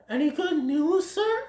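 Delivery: high-pitched character voice; hopeful Flower voice. Acted. Flower asks the Great Prince for news, high hopeful voice. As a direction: ask it high, with cautious hope.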